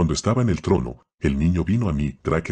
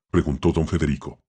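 ordinary delivery

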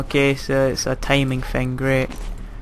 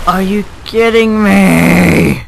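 louder, slow and higher disbelief; exasperated; 4 semitones higher, 6 dB louder, slower than usual. Real, not acted. Gamer after failing a jump, slow loud outrage. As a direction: drag out the outrage.

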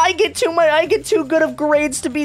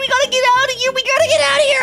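high-pitched urgent panic; panicked; 9 semitones higher, faster than usual. Acted. Kid trapped at robot school, panicked. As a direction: play frantic, needing to escape now.

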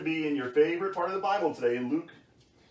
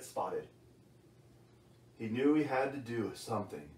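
put-on lower, gentle read-aloud voice for a child; bedtime story reading; 3 semitones lower than usual.

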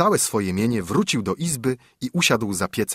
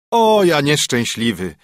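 groaning lament; 4 semitones higher, 4 dB louder than usual. A narrator's reading. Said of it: "jęknął woźnica".

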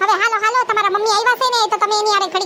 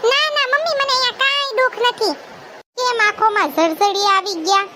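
put-on upset young daughter; 3 semitones higher than usual. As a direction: let the complaints spill out to mother. Daughter pouring out troubles.

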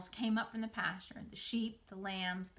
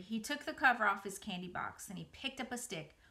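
ordinary delivery

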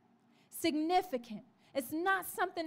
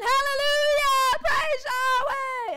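full shout, much higher and louder, Praise Yahweh stretched slow; rejoicing shout; 8 semitones higher, 8 dB louder, slower than usual. Retold or imitated. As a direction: shout the hallelujah with all your joy. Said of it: "He starts rejoicing".

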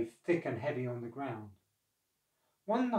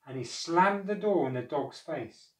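plain reading voice

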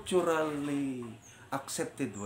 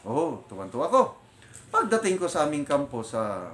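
normal talking voice

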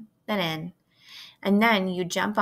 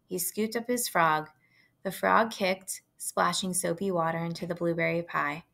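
ordinary delivery

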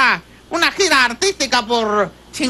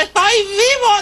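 slow, higher mock wail; wailing call; 4 semitones higher, slower than usual. Man in the coffin answers he is alive, wailing call. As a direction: draw it out as a plaintive call.

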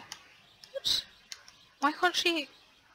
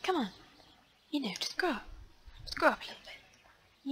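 coaxing plant whisper; 5 semitones higher than usual. Retold or imitated. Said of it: "She actually, like, talks to the plant".

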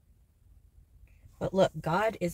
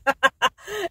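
high, loud burst of laughter; delirious laugh; 10 semitones higher, 5 dB louder than usual. Real, not acted. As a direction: cackle as if in delirium. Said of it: "laughing in delirium".

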